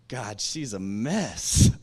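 retold snobby thought about the woman; judgmental aside. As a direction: say it with a smug sigh. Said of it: "I was like".

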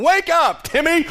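high-pitched imitation of a rousing call; dad's wake-up yell. Imitating dad coming into son's bedroom, wake-up yell. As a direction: yell to jolt a sleeping boy awake. Dad waking sleeping son, retold.

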